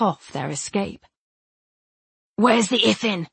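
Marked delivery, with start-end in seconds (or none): 2.4-3.3 sharp, impatient snap; snapped question. A narrator's reading. Emre demanding where the creature is, snapped. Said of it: "Emre snapped".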